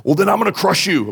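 high-pitched, hammed-up menace; mock wrathful threat. What God is not like, mock wrathful threat. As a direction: put on a cartoonish burst of wrath. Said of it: "he's not like".

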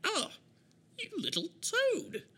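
very high, slow indignant squawk; outraged villain. Acted. Game master replaying the villain's outburst for a newcomer, high indignant squawk. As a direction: squeal the insult in a high put-on voice. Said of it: "you're gonna hear a".

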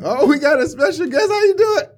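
mock announcer greeting. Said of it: "Oh, I'm just playing".

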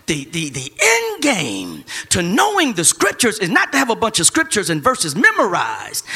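ordinary delivery

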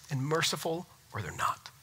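soft, breathless whisper. Real, not acted. Preacher ending his point to the church in a whisper. Quiet close of a sermon point.

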